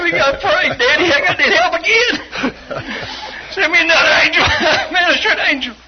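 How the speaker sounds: high, loud comic cry for help; laughing mock plea. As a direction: beg heaven for help through laughter. Comic retold plea to God.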